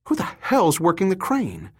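high, sharp angry outburst; cursing. Acted. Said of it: "Summer cursed".

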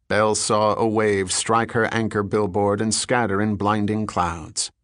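plain reading voice

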